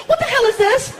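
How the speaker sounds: put-on high, indignant girlfriend voice; shrill her up in disbelief; mock girlfriend. Girlfriend confronting him over cakes, high and indignant.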